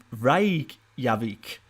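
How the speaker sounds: slow, drawn-out Scandinavian accent on Reykjavik. Shown as a demonstration. Streamer trying the Icelandic name in a Scandinavian accent. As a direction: say Reykjavik the Scandinavian way, stretching each syllable. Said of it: "now I sound really Scandinavian".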